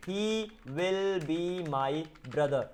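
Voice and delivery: very slow, each word held apart. Shown as a demonstration. Teacher giving learners the English for a Hindi sentence, word by word. Teacher spells out the translation slowly.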